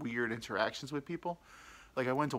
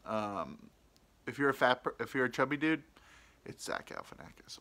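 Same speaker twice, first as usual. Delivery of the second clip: ordinary talk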